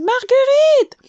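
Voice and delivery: high, loud, drawn-out call. A narrator's reading. The worried lady searching from room to room, calling the queen's name. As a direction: call her name across the hive. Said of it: "répéta Églantine".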